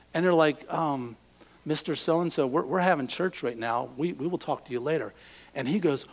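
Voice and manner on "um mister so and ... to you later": quiet, hesitant church member; hushed polite reply. Retold or imitated. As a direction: answer softly, embarrassed and polite. Congregation answering landlord meekly.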